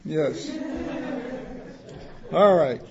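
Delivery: ordinary delivery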